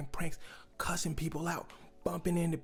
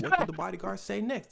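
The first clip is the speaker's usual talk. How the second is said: usual speaking voice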